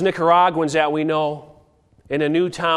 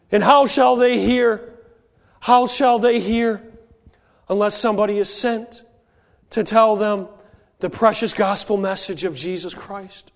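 rising preaching; 4 semitones higher than usual. Acted. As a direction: lift the congregation with the question. Preaching builds to the call.

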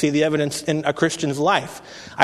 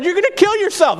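much higher, louder alarmed yell of a grandfather; retold angry shout; 4 dB louder than usual. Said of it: "He was so angry".

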